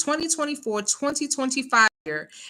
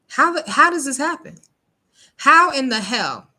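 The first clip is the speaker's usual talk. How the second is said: 1 exasperated outburst; 5 semitones higher, 6 dB louder than usual. Real, not acted. Outraged at the baby's death.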